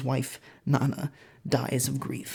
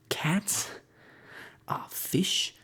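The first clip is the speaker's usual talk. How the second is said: whispered list; 5 semitones higher, 5 dB quieter, much slower than usual. Acted. Listing harmless things, whispered.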